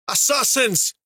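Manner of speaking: sharp high yell of alarm. A narrator's reading. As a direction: shout the warning to everyone. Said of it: "a dwarf yelled".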